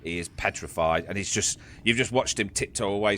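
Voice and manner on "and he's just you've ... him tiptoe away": ordinary talk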